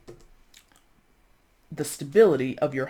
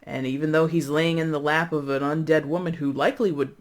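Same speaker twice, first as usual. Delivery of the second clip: usual speaking voice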